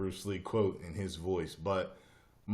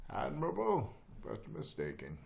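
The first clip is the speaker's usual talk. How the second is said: movie villain quote; 4 semitones higher, 4 dB quieter than usual. Retold or imitated. Remembers the line, recites it.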